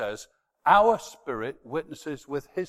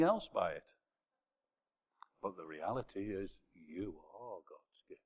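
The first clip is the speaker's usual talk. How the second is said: much softer, leaning in; hushed, intimate; 3 semitones lower, 5 dB quieter than usual. Real, not acted. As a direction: speak it gently like a secret truth. Intimate closing reflection.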